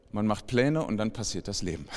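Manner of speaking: amused low repeat; laughing. Real, not acted. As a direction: let the laugh carry the proverb.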